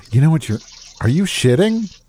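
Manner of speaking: high, teasing mock outrage; mock shocked teasing. Teasing friend on toilet.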